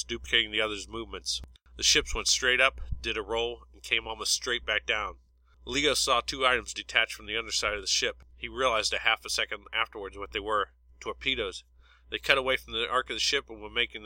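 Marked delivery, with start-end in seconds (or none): none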